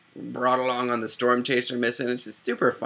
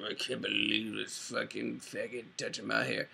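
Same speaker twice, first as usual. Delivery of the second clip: half-hearted movie star impression, hushed and disgruntled; Sean Connery; 11 dB quieter than usual. Imitating the actor grumbling about his barber, hushed put-on voice. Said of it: "Sean Connery's like".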